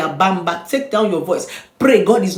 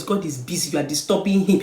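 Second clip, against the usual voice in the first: usual speaking voice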